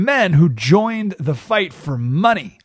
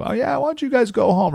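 fake nice; 9 semitones higher than usual. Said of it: "He pretended to be nice".

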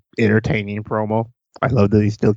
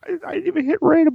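much higher, faster mocking repeat; faster than usual. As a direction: squeak it out mockingly, fast. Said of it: "repeated in mock voice".

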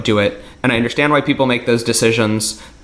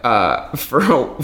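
ordinary talk

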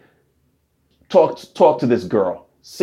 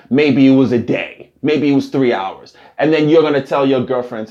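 ordinary delivery

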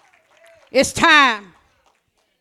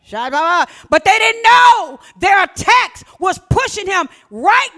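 preaching at full force; 8 semitones higher, 7 dB louder than usual. Real, not acted. Preacher declaring to the church at full force. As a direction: drive it home to the church, full volume.

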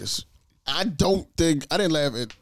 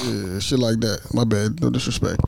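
ordinary talk

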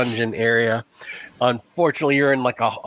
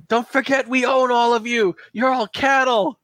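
high, strained yell at a crowd; yelled villain's threat, pitched way up; 11 semitones higher than usual. Acted. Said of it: "he starts yelling to the villagers".

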